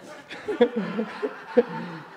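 breathy delighted laugh. Real, not acted. The preacher laughs at the soldier's amazement, delighted. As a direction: laugh at the memory of it. Laughing after retold reaction.

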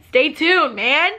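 hyped up. Real, not acted. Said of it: "hyped sign-off".

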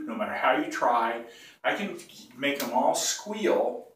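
usual speaking voice

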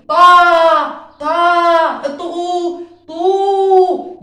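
طا and طو loud, high and long; loud stretched vowels. Shown as a demonstration. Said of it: "أنا بمد".

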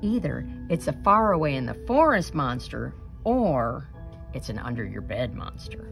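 ordinary delivery, nothing marked

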